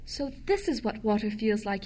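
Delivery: ordinary delivery, nothing marked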